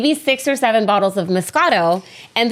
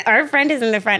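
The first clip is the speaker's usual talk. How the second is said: normal talking voice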